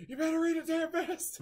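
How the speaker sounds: very high-pitched comic panic; mock frantic urging. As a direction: urge someone on in hammed-up alarm. Acting frantic urging in retold scene.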